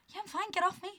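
high-pitched mock protest; high, dismissive quoted voice; retold brush-off. Friend brushes off her fussing, retold.

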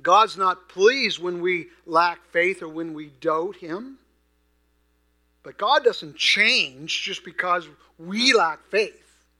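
loud, slow, emphatic declaration; forceful preaching. Real, not acted. Preacher assures congregation God stays faithful, preaching forcefully. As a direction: drive each key word home with force.

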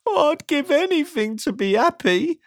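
sad, squeaky wail; high-pitched, wistful whine; Mister Miserable. A narrator's reading. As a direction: pine for it in a high, woeful voice. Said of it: "said Mister Miserable".

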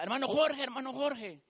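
imitated calling out. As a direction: call out to catch a friend's attention. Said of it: "y me grita".

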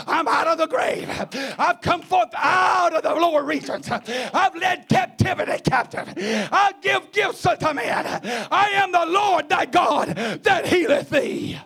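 high, ringing proclamation; full-force preaching. Real, not acted. Preacher voicing the risen Lord to the congregation, high triumphant shouting. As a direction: declare it triumphantly in shouted phrases.

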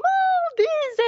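very high, loud, sing-song squeal; childlike high voice. Retold or imitated. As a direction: chirp it like an excited little kid. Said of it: "and she came".

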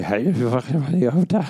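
mock yourself as a clumsy flirt; chatting up. Man at a bar to a woman, mock chatting up. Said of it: "an impression of myself chatting up a woman".

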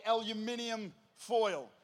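"aluminium" as al-yu-MIN-i-um; Australian pronunciation. Shown as a demonstration. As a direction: give "aluminium" all five syllables, stress on MIN. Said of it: "learn how to say it properly".

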